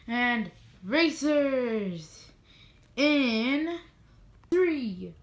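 slow start building to a loud, high shout; excited countdown. Acted. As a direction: build the countdown up to a big yell. Race countdown called out.

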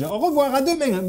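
high-pitched acted goodbye; high voice of theater people; put-on farewell. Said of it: "On me disait".

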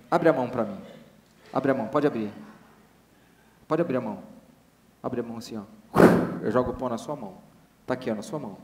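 soft, calm hypnotist instructions. Acted. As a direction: gently coax them to open the hand. Hypnotist guiding a volunteer.

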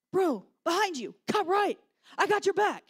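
loud, high battle-game callouts; imitating yelled commands. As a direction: shout urgent warnings to your teammate. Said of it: "Derek's yelling all these commands".

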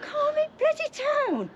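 high, slow sneering mimic of him; mocking echo. Real, not acted. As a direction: throw his words back in a mocking sing-song. Mocks agent's "calm it down.

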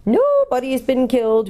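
hooting owl. A narrator's reading. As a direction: hoot it out to the deaf listener. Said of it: "hooted the owl".

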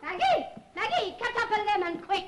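calling out. Acted. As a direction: shout for her across the house.

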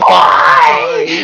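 high, loud, offended "why"; indignant cry. Acted. Friend insults hair.

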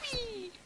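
tiny, very high squeal, softly drawn out; soft high-pitched glee; playful whee. Pretend. Player sends things flying, soft high whee.

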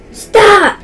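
high, loud yelling at a teammate; exasperated screaming. Real, not acted. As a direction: scream at them in frustration.

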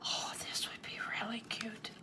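soft breathy whisper, quick; awed whisper. Real, not acted. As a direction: whisper it as if afraid to break the moment. Awed by the gift.

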